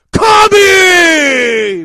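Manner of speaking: slow, very loud shout. Acted. Host giving a big shout-out to Kami, slow bellow.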